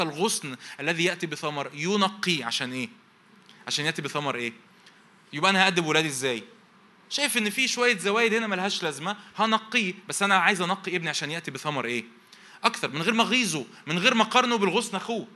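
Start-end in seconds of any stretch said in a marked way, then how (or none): 5.3-15.3 high, loud sermon voice pressing the point; preacher urging fathers, voice raised high; impassioned preaching. Acted. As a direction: drive it home to the parents. Preaching at full force.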